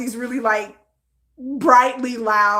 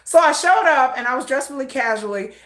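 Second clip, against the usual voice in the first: normal talking voice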